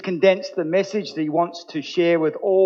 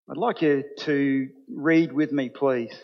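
normal talking voice

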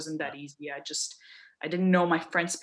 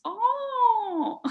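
very high, drawn-out mock "Oh"; sing-song high surprised oh; the famous oh; 5 dB louder than usual. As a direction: imitate the clerks' fascinated oh. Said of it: "the famous".

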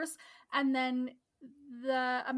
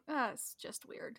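soft, breathy whisper aside; muttered reaction to the weird call; 9 dB quieter than usual. Retold or imitated. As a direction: whisper it to yourself. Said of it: "And I'm like".

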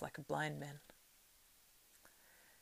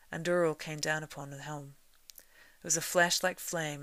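plain reading voice

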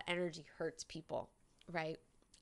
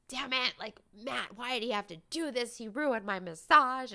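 higher, louder fed-up complaint; mad rant; 5 semitones higher, 6 dB louder than usual. Retold or imitated. Retelling her frustration at Matt during the massage, a higher, louder mock rant. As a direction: vent at Matt under your breath then louder. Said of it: "I was, like, so mad".

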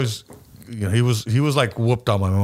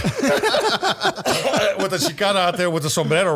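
slow, laughing retake of the line; laughing, drawn out; 5 semitones higher, slower than usual. Shown as a demonstration. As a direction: stretch it out through a laugh. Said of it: "That's better".

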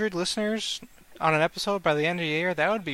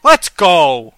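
loud, slow victory shout; loud cheer; 13 dB louder, much slower than usual. Real, not acted. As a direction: belt it for the Sox. Cheering the game.

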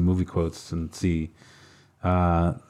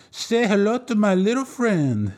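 Scarface imitation; 12 semitones higher, slower than usual. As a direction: boast it like a gangster. Quiz quote as Scarface.

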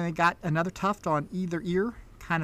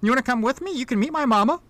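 put-on higher, friendly country child; little girl; 7 semitones higher, 5 dB louder, faster than usual. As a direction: invite like a small girl. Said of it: "the little girl pipes up".

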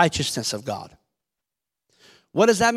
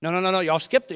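ordinary talk, nothing marked

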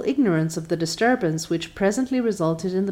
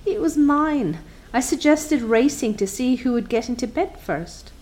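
contrite; 6 semitones higher than usual. A narrator's reading. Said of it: "said Anne contritely".

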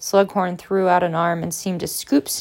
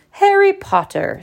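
high, loud theatrical professor; vibrant tones; 13 semitones higher, 7 dB louder than usual. A narrator's reading. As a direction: ring the name out dramatically. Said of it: "said Professor Trelawney in deep, vibrant tones".

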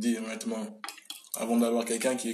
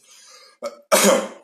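sudden loud cough from pepper; coughing fit; 2 semitones higher, 14 dB louder than usual. Real, not acted. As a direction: cough hard on spicy food. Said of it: "Ah, j'ai mis trop de poivre".

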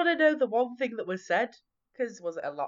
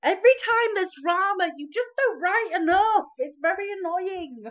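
exasperated; 11 semitones higher, 4 dB louder than usual. Real, not acted. Friend nags about vague diary.

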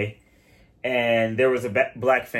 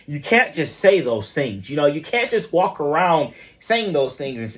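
hurt Karen voice; 3 semitones higher than usual. Retold or imitated. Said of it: "She's like".

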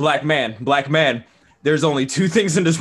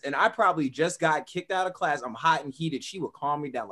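ordinary delivery

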